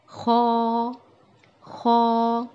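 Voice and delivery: خو from the upper throat, like gargling, lips slightly rounded; Arabic kha sound. Shown as a demonstration. Teacher demonstrates خو for students, throaty gargled kh. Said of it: "کس، کس طرح؟".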